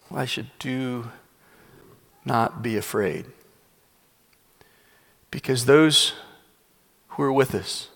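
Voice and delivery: measured, unhurried, with long pauses; steady the listener, leaving space between phrases; calm reassurance. Acted. Prophet reassures servant facing an army, calm.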